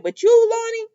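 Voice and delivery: high-pitched stress on "you, Lonnie"; pointed warning. Retold or imitated. Paulina warns Lonnie, singling out "you" high and pointed. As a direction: lift "you" sharply high, then name Lonnie.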